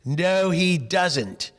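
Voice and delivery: a lightly voiced, slow father's no; slow firm refusal. Father refusing the coach, slow and firm. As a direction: plant your feet and say it slowly.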